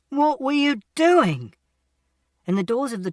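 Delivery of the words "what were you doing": high, loud, slow demanding shout; the man demanding an answer from the silent boy; exasperated yell. A narrator's reading. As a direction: spell out the question in disbelief. Man berates boy after rescue.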